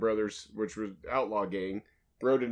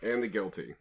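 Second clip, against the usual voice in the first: low-key, softer toss-off; absent, no passion; 4 semitones lower, 6 dB quieter than usual. Real, not acted. Co-host mumbles through the show's catchphrase, no heart behind it. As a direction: say it like you're barely here. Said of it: "like you weren't even here".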